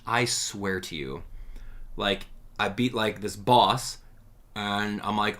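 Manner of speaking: ordinary talk, nothing marked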